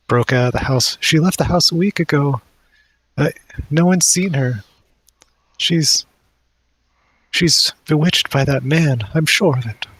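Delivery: high, distressed character voice; weepy mother. Acted. Mother tells visitors of her bewitched daughter, weepy. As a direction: lament in a high, weepy pitch.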